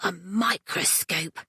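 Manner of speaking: put-on boy dreaming of a gift; dreamy. A narrator's reading. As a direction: drift off on it. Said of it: "said Bertram dreamily".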